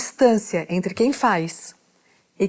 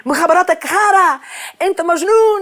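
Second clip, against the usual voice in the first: angry yelling; 8 semitones higher, 5 dB louder than usual. Retold or imitated. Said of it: "eu tô toda brava".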